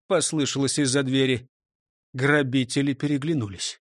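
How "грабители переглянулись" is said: ordinary delivery, nothing marked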